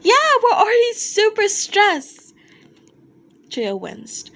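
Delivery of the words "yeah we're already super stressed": high, loud anxious yell; stressed fan shout. Acted. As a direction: shout it, nerves frayed. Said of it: "added with a shout".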